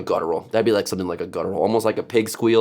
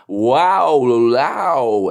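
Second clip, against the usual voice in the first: open-mouth scream; 3 semitones higher, 4 dB louder than usual. Shown as a demonstration. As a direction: open wide and enunciate. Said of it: "you open your mouth more".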